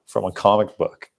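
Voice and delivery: ordinary delivery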